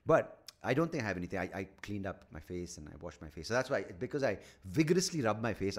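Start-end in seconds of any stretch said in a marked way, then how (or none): none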